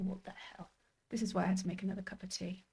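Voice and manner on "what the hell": breathy whisper, under the breath; whispered aside. Real, not acted. Streamer to herself about live viewers, whispering. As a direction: mutter it to yourself, voiceless.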